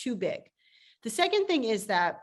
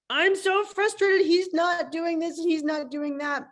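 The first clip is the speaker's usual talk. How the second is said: frustrated; 6 semitones higher, 4 dB louder, slower than usual. Retold or imitated. Board member venting frustration.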